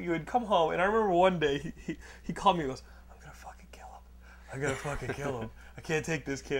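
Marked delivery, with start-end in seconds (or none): 3.1-4.0 barely audible seething whisper; whispered threat. Retold or imitated. Brother phoning about his hated roommate, whispered threat. Retold call from exasperated brother. 4.5-6.6 tag. Retold or imitated. Retold call from exasperated brother.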